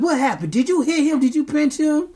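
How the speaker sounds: mock accusing parent; high questioning parent. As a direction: press the kid for answers. Parent quizzing kid, raised.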